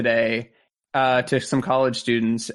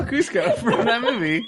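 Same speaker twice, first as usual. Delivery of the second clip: laughing; 8 semitones higher than usual. Real, not acted. Laughing joke.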